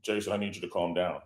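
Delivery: low and throaty, calming him; blood in her throat. Retold or imitated. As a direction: angel voice telling Jason to calm down, low and soft. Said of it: "talks a little bit with blood in her throat".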